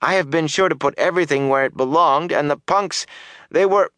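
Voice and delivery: high, defensive excuse-making; protesting. A narrator's reading. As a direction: plead your case until cut off. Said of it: "the man protested".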